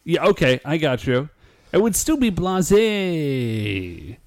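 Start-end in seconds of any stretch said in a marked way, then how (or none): 1.7-4.2 blase stretched very long as blah-ZAAAY; slow, sliding, elongated blase; drawn-out insistence. Shown as a demonstration. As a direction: tease by holding the end of blase. Teasing insistence on the other pronunciation.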